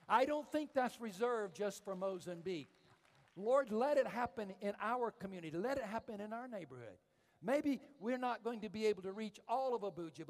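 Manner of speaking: loud, high, impassioned preaching; fervent preaching. Real, not acted. Preacher prays for revival in his own community, fervent preaching. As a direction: plead with God and the crowd at full force.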